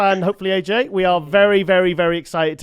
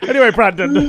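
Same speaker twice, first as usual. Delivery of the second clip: laughing recovery; 5 semitones higher, 6 dB louder than usual. Real, not acted.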